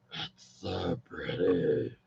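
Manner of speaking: very deep, slow rasping growl; demon voice. Acted. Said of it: "That's my demon voice".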